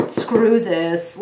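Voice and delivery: loud dismissive outburst; fed-up quip. Retold or imitated. Voicing reader done with the drama, dismissive. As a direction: throw it away with a scoff. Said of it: "It feels like".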